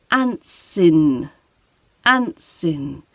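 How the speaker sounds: Antsin with the T of ant dropped, run into in; linked antsin. Shown as a demonstration. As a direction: Antsin said as one smooth word. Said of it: "we're losing the T sound of ant, and it's running in to the in".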